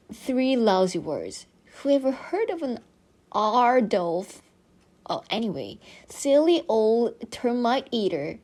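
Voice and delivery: ordinary delivery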